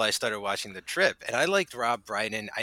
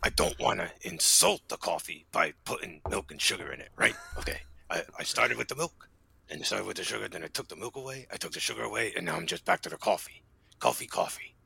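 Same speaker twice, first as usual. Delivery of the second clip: mock Pacino; 2 semitones lower, 4 dB louder than usual. Mimics Pacino's coffee ad.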